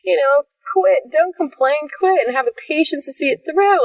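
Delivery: mock fan cheering. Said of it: "I was like".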